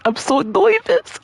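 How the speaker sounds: high, slow "noy-vis" for "nervous"; playful mispronunciation. Real, not acted. Anxious gamer joking about his nerves, "nervous" as high "noy-vis". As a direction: lift "nervous" into a rising, stretched "noy-vis".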